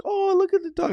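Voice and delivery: very high-pitched cooing impression; imitated dog admirer. Man spotting a dog in a car, imitated high cooing. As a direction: coo mockingly like a stranger at a dog.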